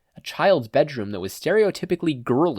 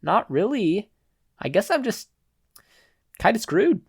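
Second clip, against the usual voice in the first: indifferent shrug; 10 semitones higher, 3 dB louder than usual. Acted. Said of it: "with indifference".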